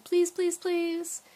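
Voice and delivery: high begging, please after please; insistent pleading. A narrator's reading. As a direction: beg like an eager kid. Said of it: "And with each please".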